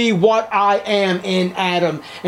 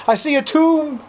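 eager convert; 9 semitones higher than usual. Acted. Sinner answers the preacher.

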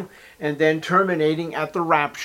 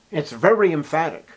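normal talking voice

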